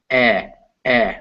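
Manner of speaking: short clipped eh, eh. Shown as a demonstration. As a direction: say eh, eh as a short lax vowel. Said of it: "That's an English E sound".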